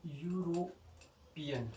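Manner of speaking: यूरोपियन drawn out very slowly, starting with yu; careful pronunciation. Shown as a demonstration. Teacher demonstrates the word slowly for students. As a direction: spell out यूरोपियन slowly and clearly. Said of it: "अब आप clearly बता सकते हो कि".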